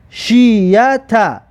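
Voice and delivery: very slow, drawn-out syllables; slow teacher's model reading. Shown as a demonstration. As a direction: stretch each syllable for learners to copy. Teacher models the joined word.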